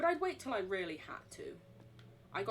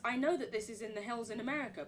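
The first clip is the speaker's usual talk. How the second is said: normal talking voice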